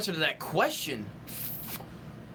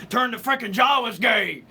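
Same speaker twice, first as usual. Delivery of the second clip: shouted Alex Jones rant, louder; Alex Jones impression; 3 semitones higher, 5 dB louder than usual. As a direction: bellow it like a conspiracy radio host. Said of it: "Alex Jones".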